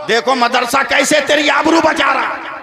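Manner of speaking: loud, high and slow declamation; preaching at full force. Real, not acted. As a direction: proclaim it to the crowd. Climactic appeal to audience. (पूरे जोश से तक़रीर)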